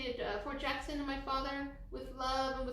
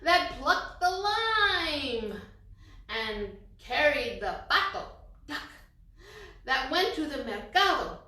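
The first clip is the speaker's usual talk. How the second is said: louder, slower, building read-aloud; emphatic storytelling; 3 semitones higher, 9 dB louder, slower than usual. A narrator's reading. Reading the cumulative rhyme to kids, louder storytelling. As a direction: read it big for the children.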